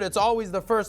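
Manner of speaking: ordinary talk, nothing marked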